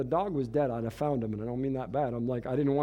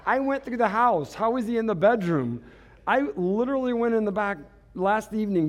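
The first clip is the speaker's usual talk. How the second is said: higher, louder, laughing through it; incredulous, half laughing at himself; laughing in disbelief at the missing dog; exasperated laughing disbelief; 6 semitones higher, 4 dB louder than usual. Retold or imitated.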